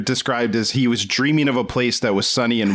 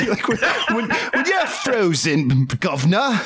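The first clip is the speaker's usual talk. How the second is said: hammed-up Cockney, higher, laughing through it; mock Cockney; 8 semitones higher than usual. Host spoofing the frozen man's speech, mock Cockney. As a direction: stumble laughing into a silly Cockney voice. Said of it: "everything but Cockney".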